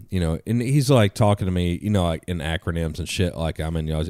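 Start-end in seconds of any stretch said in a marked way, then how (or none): none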